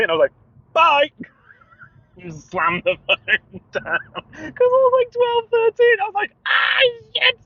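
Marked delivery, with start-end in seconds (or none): 0.7-1.1 high, loud yelped farewell; panicked blurt. Retold or imitated. Embarrassed boy ending a phone call, panicked yelp. 2.2-6.3 high, squeaky talk through laughter; laughing. Real, not acted. Telling his childhood phone blunder, helpless laughter. 6.5-7.4 very high squealed cry; mock panic. Boy realising his phone blunder, hammed-up panic. Retold panic after hanging up.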